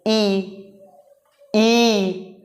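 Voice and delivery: teacher sounding out a clear drawn ई; vowel demo. Shown as a demonstration. Teacher shows students the front vowel, clear sustained ई. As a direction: hold ई as a pure front vowel for the class. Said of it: "बोल के देखो".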